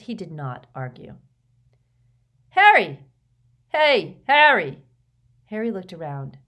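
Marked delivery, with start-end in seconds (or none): 2.5-4.8 loud, high call across a corridor; loud high hail. A narrator's reading. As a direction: shout to catch his attention.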